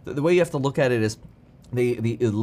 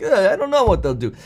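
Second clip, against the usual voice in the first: mock shrugging-it-off voice, higher; Putin; 6 semitones higher, 4 dB louder than usual. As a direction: lightly act out disowning all blame. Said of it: "washing his hands of".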